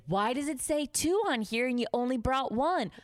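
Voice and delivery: high, indignant imitated demand; confrontational boss. Boss confronting waitress with the ticket, acted-out scolding.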